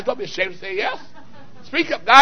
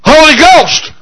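proclaimed; 7 semitones higher, 4 dB louder than usual. Real, not acted. Preacher proclaims preferred term.

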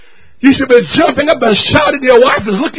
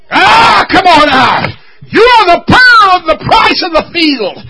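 loud, high-pitched whooping sermon delivery; fervent preacher crying out to the church; full-force preaching; 3 semitones higher, 5 dB louder than usual. Acted. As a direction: preach it out with everything you've got.

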